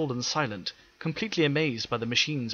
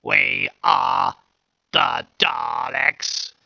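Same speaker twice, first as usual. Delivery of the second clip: louder, very slow harsh robot voice, nearer a natural register; second Dalek voice; 7 dB louder, much slower than usual. Acted. The second Dalek naming its kind, slow harsh voice. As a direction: drag the name out mechanically. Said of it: "although more of a natural register, retained the harshness".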